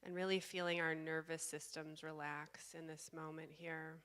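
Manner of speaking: slow, calm meditation guide with long pauses; guided meditation. Real, not acted. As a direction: guide them slowly into stillness, leaving space between phrases.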